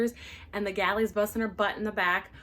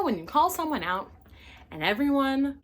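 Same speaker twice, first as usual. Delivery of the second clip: ordinary delivery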